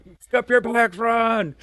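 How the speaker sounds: high, loud barked order to run; drill sergeant yell. Retold or imitated. Drill sergeant yelling at a dawdling recruit. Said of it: "He was like".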